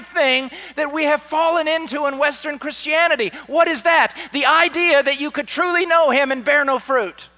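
high, loud, driving sermon delivery; full-force preaching. Real, not acted. Preacher warning the congregation against fruitless faith, at full force. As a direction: preach it with mounting, insistent force.

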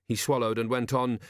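usual speaking voice

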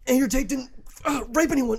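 high, loud hammed-up insistence; mock passionate protest. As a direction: act out a worked-up, breathless defence. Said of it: "viciously passionate about it".

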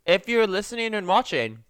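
normal talking voice

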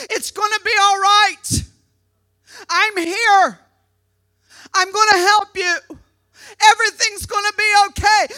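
imitating a dad shouting comfort; urgent reassurance. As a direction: call out comfort over a screaming child. Dad calling over her screaming.

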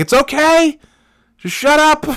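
loud, high-pitched exasperated yell; retold yell. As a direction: yell it out to cut off an apology. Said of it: "I just yelled out".

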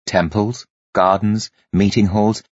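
ordinary delivery

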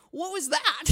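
loud, high baffled exclamation; husband and wife stunned, loud baffled what was that. Retold or imitated. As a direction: throw it out in disbelief. Retelling shock with husband.

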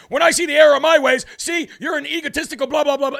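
high, fast mock indignation; mocking imitation. Critics taking his words as a shot, mocking high voice. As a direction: parrot their offended comeback, racing into blahs. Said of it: "That's how they take it".

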